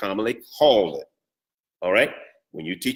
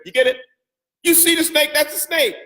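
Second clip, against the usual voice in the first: high, bright teasing call on "You see the snake"; playful facetious; 12 semitones higher than usual. Shown as a demonstration. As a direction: toss it off jokingly, much higher. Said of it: "Just be a little fun, facetious there".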